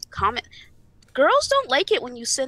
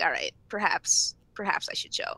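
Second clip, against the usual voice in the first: grudging whisper; 5 semitones lower, 4 dB quieter than usual. Retold or imitated. To a trusted friend, a grudging quiet whisper. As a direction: whisper it like giving in. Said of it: "I'd be like".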